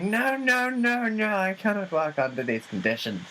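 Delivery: high, haughty diva refusing; pampered star whining at her crew; diva tantrum. Pretend. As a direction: throw a prima donna fit, squealing the no's. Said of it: "complete diva people".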